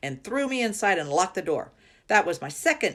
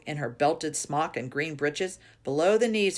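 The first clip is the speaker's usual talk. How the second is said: usual speaking voice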